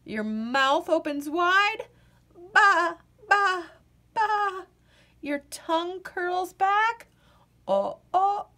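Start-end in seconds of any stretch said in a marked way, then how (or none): none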